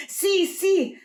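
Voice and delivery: very high, excited stumbling promise; eager shout. Acted. Fernando promising old Juana to obey, high excited shout. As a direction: blurt the promise in a rush of eagerness. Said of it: "shouted Fernando".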